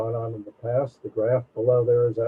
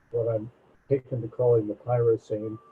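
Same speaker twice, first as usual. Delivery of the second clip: ordinary talk, nothing marked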